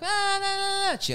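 high mocking gibberish; mock chatter. Mimicking the girls talking again, high. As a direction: squeak their babble back. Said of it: "they're like".

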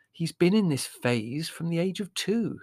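a hint of a patient mother; lightly voiced Mother, resigned.

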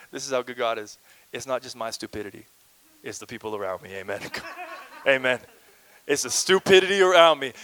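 ordinary delivery

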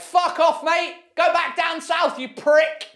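high, loud mock northern heckle; angry northerner. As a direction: heckle him off the stage. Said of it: "everyone's just gonna be like".

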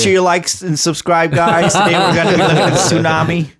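high, loud YouTuber intro. Pretend. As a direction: hype it up like a vlogger.

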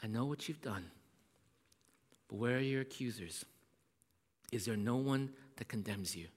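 soft, low and gentle, tender Jesus; Jesus speaking tenderly to the ashamed woman. Acted. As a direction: comfort a broken woman softly. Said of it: "she hears the voice of Jesus saying".

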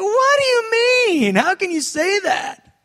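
shocked protest to God; incredulous protest. Retold or imitated. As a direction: demand it in disbelief. Arguing with God.

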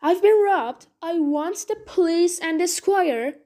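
loud and high, still crying out; distraught man pleading loudly for help. Acted. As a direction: keep crying it out, high and urgent. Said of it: "cried Silas".

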